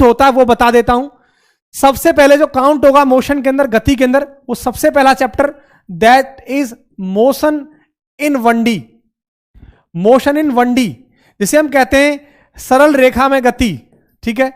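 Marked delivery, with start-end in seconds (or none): 5.9-8.7 very slow, each word drawn out for students; slow dictation. Shown as a demonstration. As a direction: spell the term out slowly as if dictating. Teacher dictating a term. (बहुत धीरे, बोलकर लिखवाते हुए)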